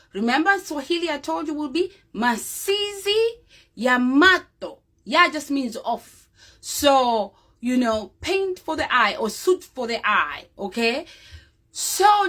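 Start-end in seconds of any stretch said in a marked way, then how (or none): none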